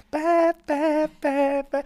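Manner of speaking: loud high-pitched "bah" bursts; imitated car noise. Imitating car noise while driving around.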